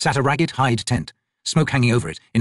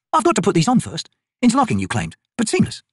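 lightly raised soldier voice; brisk, higher woman soldier; Picker's voice; 10 semitones higher than usual. Acted.

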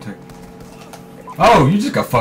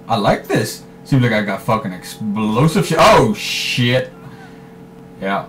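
ordinary talk, nothing marked

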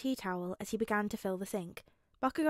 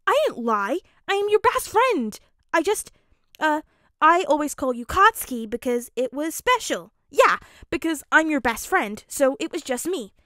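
loud, high, eager insistence; earnest protest; 6 semitones higher, 12 dB louder than usual. Acted. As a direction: insist excitedly on being the best friend. Kirishima hotly defends himself to Bakugo.

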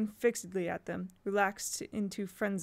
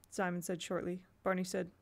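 plain reading voice

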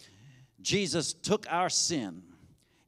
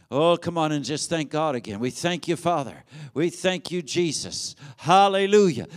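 ordinary delivery

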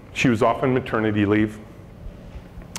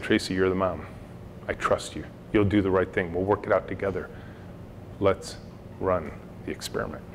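reassuring; 5 semitones lower, 7 dB quieter than usual. Retold or imitated. Boss reassuring a new mom.

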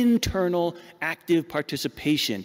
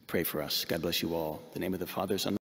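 hushed prayer, much softer and lower; closing blessing; 9 semitones lower, 8 dB quieter than usual. Real, not acted. Priest closing the sermon with a blessing, hushed and low. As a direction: bless the congregation, softly and lower.

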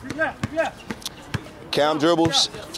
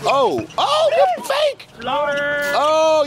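excited cry; 6 semitones higher, much slower than usual. Real, not acted. Reacting to a fake on court.

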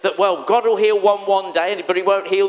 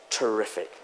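ordinary talk

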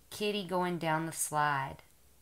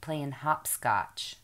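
put-on reading to a toddler; 3 semitones higher, 3 dB louder than usual. Mother reading picture book to toddler.

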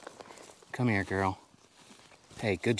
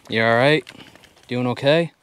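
concerned calling; 5 semitones higher, 14 dB louder than usual. Real, not acted. Checking on the dog.

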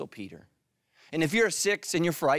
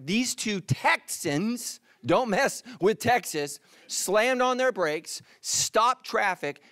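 slow, higher, drawn-out phrases; dramatic storytelling; 4 semitones higher, much slower than usual. Real, not acted. Preacher builds a road rage story, drawn out and dramatic. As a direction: stretch each phrase for comic suspense.

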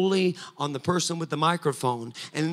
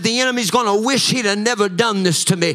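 louder and higher, chanting, building preaching; preaching at full force; 5 semitones higher, 8 dB louder than usual. Acted. Pastor declaring victory over the enemy to the church, full-force preaching. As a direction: drive each "coming out" line harder, near song.

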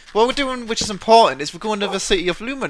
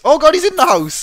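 higher, louder alarmed shout; panicked shout; 7 semitones higher, 6 dB louder than usual. Real, not acted. Player shouting to friends as the creeper gets inside, panicked. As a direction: raise the alarm, startled.

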